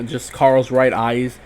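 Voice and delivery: usual speaking voice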